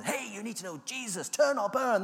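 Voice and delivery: loud high street-preacher call; hammed-up street preaching; mock preacher shouting. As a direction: bellow it like a pushy evangelist. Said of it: "virtually shouting".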